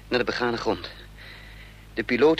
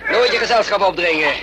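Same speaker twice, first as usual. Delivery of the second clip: loud and higher, a fighter's victorious cry; triumphant shout; 4 semitones higher, 6 dB louder than usual. Acted. Hero to Lillet after flooring the two agents: loud triumphant shout.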